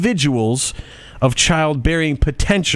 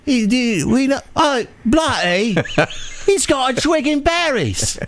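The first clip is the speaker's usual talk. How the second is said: mock character voice; 9 semitones higher than usual. Mock voice of someone, laughing.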